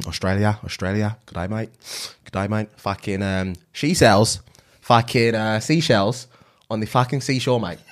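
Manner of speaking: halting comic Aussie attempt; Australian accent. Shown as a demonstration. Saying the set phrase in an accent game, Australian accent. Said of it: "what did I say to say".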